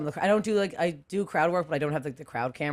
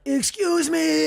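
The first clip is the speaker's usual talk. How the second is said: high, loud and slow, dragged-out excuse me; pointed excuse me; 11 semitones higher, 5 dB louder, much slower than usual. Retold or imitated. To a heckler glued to his phone, a loud, slow, pointed excuse me. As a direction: stretch it out, high and loud, demanding attention.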